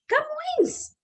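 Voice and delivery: high-pitched exclamation of the name; excited reveal. Real, not acted. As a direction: announce it like a quiz answer. Teacher reveals poet with flourish.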